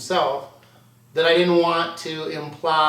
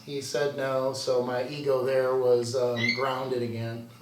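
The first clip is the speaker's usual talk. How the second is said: ordinary talk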